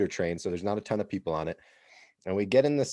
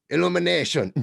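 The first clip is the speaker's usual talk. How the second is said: put-on young Italian guy; 7 semitones higher, 6 dB louder than usual. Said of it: "he goes".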